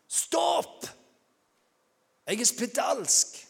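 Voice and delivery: loud high cry of warning; shouted warning. Acted. Leper to an approaching stranger on a walk, shouted warning. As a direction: shout it out to keep someone away. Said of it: "måtte rope ut".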